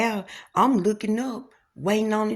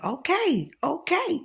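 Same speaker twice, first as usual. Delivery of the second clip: agreeing; 9 semitones higher than usual. Retold or imitated. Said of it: "I said".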